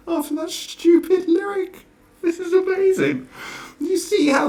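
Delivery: loud, high incredulous sarcasm; outraged disbelief. Real, not acted. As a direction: throw it up high in mock amazement. Outraged rant at the article.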